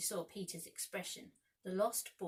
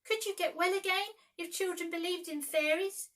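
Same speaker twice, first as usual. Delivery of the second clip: high, loud pleading question; desperate; 10 semitones higher, 6 dB louder than usual. A narrator's reading. Peter asking dying Tink, high and loud. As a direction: beg for any hope.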